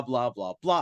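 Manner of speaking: ordinary delivery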